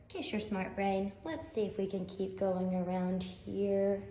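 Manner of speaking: usual speaking voice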